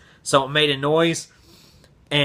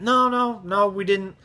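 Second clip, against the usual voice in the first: lightly voiced higher denial; coworkers' denial; 5 semitones higher than usual. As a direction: imitate coworkers hurriedly denying it.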